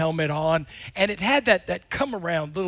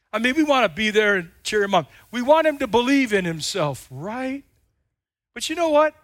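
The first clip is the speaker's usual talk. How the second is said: ordinary talk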